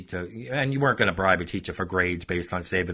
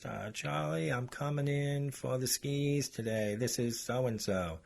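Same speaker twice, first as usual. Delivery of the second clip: put-on a lightly done surfer accent, higher, softer and slow; mock the teacher with a slow, soft surfer drawl; 5 semitones higher, 7 dB quieter, slower than usual. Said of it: "like, surfer kind of accent".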